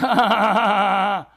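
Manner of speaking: big theatrical laugh; booming character laugh; hearty villain-ish laugh. Acted. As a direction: laugh like a showman. Doctor's laugh.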